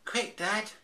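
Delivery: high, loud cub yell; urgent shout. Acted. Baby Bear urging his father to chase her, shouting. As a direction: call out in a hurry. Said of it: "screamed Baby Bear".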